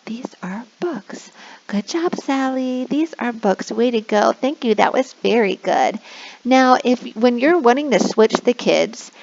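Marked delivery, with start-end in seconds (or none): 0.0-1.3 whispered slowly like a secret; hushed, slow whisper to a child. Shown as a demonstration. Whispered model answer. 1.7-6.0 put-on sing-song praise for a little kid; kindergarten teacher voice. Shown as a demonstration. Praising child.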